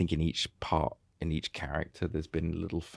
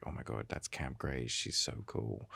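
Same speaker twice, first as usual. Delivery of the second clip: a hushed, starstruck aside; hushed awe; 5 semitones lower, 10 dB quieter, slower than usual. Retold or imitated. Friend sees her idol across the room, hushed and awed. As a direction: breathe it like a starstruck fan.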